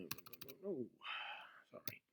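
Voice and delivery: ordinary talk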